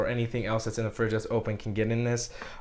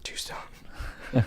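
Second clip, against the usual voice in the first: whispering; 5 dB quieter than usual. Acted. Echoing partner's phrase in whisper.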